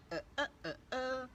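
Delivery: glitchy robotic stutter; robot voice, breaking up like a bad signal. Shown as a demonstration. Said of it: "robot or a Dalek".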